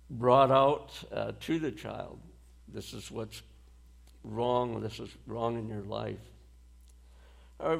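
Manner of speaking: usual speaking voice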